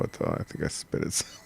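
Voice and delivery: whispered sheepish admission. Real, not acted. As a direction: confess it under your breath. Embarrassed confession.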